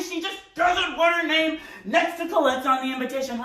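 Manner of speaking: high, strained, emphatic protest; exasperated outburst. Acted. Son shouting mother's objection at fiancée, exasperated. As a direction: blurt it out at the end of your patience.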